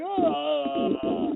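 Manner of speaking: sudden high shriek; freaked-out shriek. Retold or imitated. Retelling the moment it hit him: high shriek. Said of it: "I literally freaked out".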